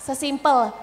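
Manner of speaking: ordinary delivery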